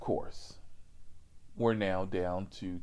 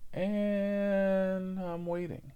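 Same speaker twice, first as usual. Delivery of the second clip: slow, higher, stretched out; drawn out waiting; 9 semitones higher, much slower than usual. Shown as a demonstration. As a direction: drag out the wait impatiently. Repeated waiting, escalating.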